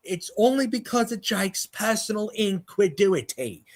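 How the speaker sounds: high smug voice; a hint of a preachy debunker; impression of Simon Scam. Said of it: "that just now was my impression of".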